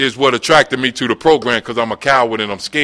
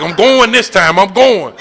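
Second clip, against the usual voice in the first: mock bravado; 7 semitones higher, 5 dB louder than usual. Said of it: "I was like".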